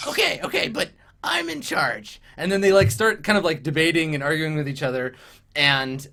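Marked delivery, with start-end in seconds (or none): 0.1-2.2 high-pitched bossy goblin; Crust. Acted. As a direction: assert command in a squeaky goblin voice. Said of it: "and so then Crust says".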